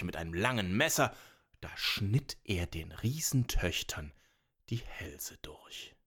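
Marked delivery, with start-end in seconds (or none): 4.7-5.9 slow, soft whispered; dark storyteller whisper; ominous whisper. A narrator's reading. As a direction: hush it out slowly, grimly. Grim narration of killing.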